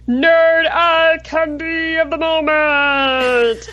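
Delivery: long drawn-out, held-note announcer call; deep breath, then stretch the segment title out slowly; grand, sustained jingle-like title call; big segment announcement. Pretend. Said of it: "I have to take in a deep breath".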